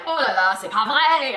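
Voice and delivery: loud exasperated oh la la; rising French frustration, loud; annoyed French exclamation; worked up. Retold or imitated. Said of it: "I'm getting worked up".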